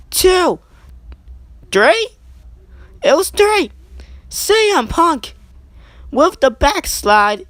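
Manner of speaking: high, loud pinfall call, drawn out; excited shout. Acted. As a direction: yell the three count as the crowd erupts. Climactic three count pinfall call.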